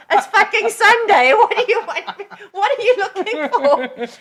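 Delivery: high, loud mock protest with laughter; retold exasperated reply. Friend bothered on a Sunday by an odd request, mock exasperation. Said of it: "And I'm like".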